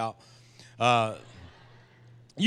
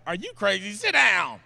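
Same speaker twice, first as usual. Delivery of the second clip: mock-scolding, pitched up; exasperated wife; 5 semitones higher, 5 dB louder than usual. Wife to husband dancing mid-movie, mock exasperation. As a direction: imitate a wife ordering him to stop, louder at the end.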